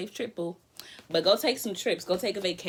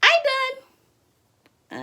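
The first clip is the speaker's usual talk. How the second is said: high squeal; 8 dB louder than usual. Real, not acted.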